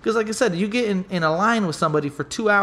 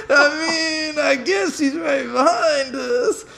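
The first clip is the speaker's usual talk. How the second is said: laughing; 10 semitones higher, much slower than usual. Real, not acted. Cracking up retelling line standoff.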